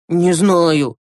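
a touch of helpless sighing; mildly burdened, sighed reply; heavy exhale. Acted. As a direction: breathe it out heavily. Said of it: "тяжело выдохнул Юрасик".

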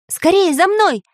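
high-pitched urgent call; rally everyone in a high voice; urgent command. A narrator's reading. Samodelkin orders the escape, urgent command. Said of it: "скомандовал Самоделкин".

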